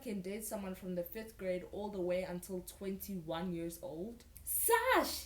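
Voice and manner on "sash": high, loud excited cry; screamed greeting. A narrator's reading. Girl spotting her best friend Sasha, screaming. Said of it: "you scream".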